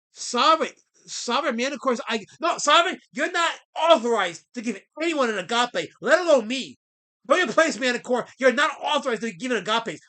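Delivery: loud, indignant telling-off of a chatter; angry scolding. Real, not acted. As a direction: put him in his place, loud and sharp. Scolding a chatter who gave agape.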